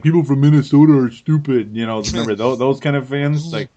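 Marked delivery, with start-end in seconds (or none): 0.0-1.8 mock rude fan. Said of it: "one of those guys that's like".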